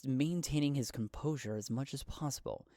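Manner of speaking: usual speaking voice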